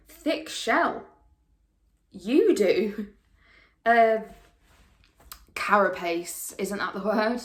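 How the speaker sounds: loud, emphatic, drawn-out reproach; pointed accusation. Acted. Accusing Kristen of turning hard, pointed and forceful. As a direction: press each charge home slowly and forcefully.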